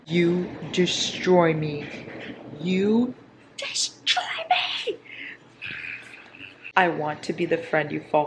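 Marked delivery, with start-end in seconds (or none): 0.1-1.9 slow, drawn-out dramatic delivery. Pretend. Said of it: "reading title dramatically". 2.6-5.0 breathless high whisper; dramatic whisper. Pretend. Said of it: "repeats title whispered".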